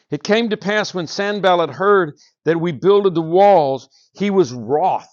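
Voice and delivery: slow, loud and solemn reading of the verse; scripture reading. Acted. Preacher reads scripture aloud, slow and loud. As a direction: read it out slowly to the congregation. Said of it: "listen to these words".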